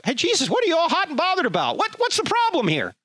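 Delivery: high, fast mocking bystanders; shrill what's the problem voice. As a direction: mimic the clueless crowd. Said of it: "Everybody's like".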